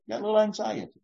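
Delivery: normal talking voice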